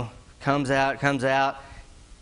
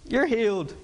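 mock Jesus healing; 6 semitones higher than usual. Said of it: "Jesus could have just said".

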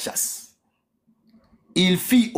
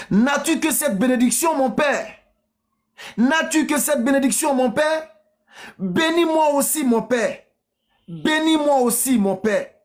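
high, imploring son; wronged son pleading with his father; acted tearful plea; 7 semitones higher than usual. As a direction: beg insistently in a raised voice. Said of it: "Esau éleva la voix et pleura".

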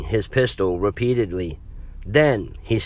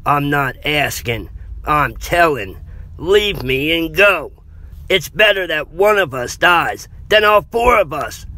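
firm, raised, forceful delivery; controlled insistence, building higher and louder; calm but intense; 6 semitones higher, 4 dB louder than usual. A narrator's reading. Jeff orders Aaron to leave him, intense and controlled. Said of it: "very calm but very intense voice".

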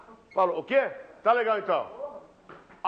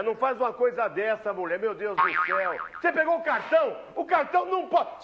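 ordinary delivery

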